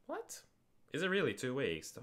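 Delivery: sudden high squeak of disbelief; shocked, half-squealed "What?"; surprised. Real, not acted. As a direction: caught off guard by the date. Surprised it is two weeks.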